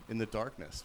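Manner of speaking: normal talking voice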